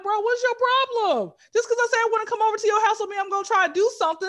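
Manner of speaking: high, loud, fast exasperated shout at a man. Retold or imitated. Telling a presumptuous guy off, loud and fast. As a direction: yell it fast, fed up. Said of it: "I'm like".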